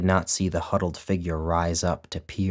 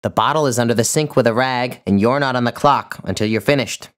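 loud, clipped commands from the boss; bossy order; 6 semitones higher, 8 dB louder than usual. Acted. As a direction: put the servant in her place. Employer orders maid to clean.

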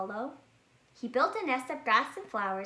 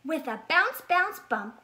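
higher, louder, springy bounce; bouncy narration; 6 semitones higher, 7 dB louder than usual. A narrator's reading. Narrator hops along as the rabbit arrives. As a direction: bounce the words like a hopping rabbit. Bouncy sound words in story.

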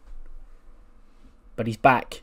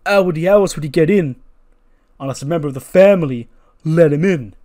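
raised-voice accusation; Jey Uso; 4 semitones higher, 7 dB louder, faster than usual. Acted. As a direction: throw the question out loud and pointed. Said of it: "And he goes".

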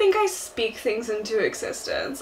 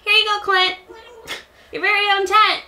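high, loud, chirpy helper voice; little shop helper; 10 semitones higher, 4 dB louder than usual. Acted. Handing Clint a tent, high chirpy helper voice. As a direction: pipe it up high and bright. Plays Timmy and Tommy.

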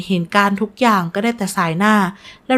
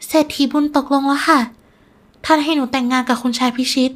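higher, small dutiful voice; subdued daughter; 5 semitones higher than usual. Acted. Betrayed daughter lying to her father, subdued. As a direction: report it meekly, pitched up. Sad daughter forced to lie.

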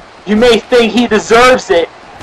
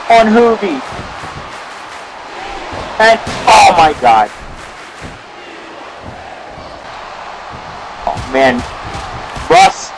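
live ringside play-by-play, rising to shouts; wrestling commentary; 2 semitones lower than usual. Pretend. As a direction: call the match like a wrestling announcer.

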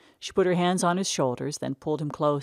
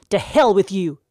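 loud, higher furious yell; angry shout; 5 semitones higher, 10 dB louder than usual. Acted. Jed storming off after she ignored him, loud angry shout. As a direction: hurl the curse back at the hall. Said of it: "he shouted".

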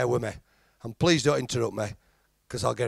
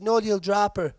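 woman refusing; 7 semitones higher than usual. Acted. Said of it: "She said".

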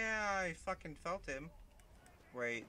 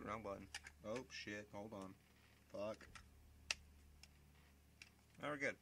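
ordinary talk

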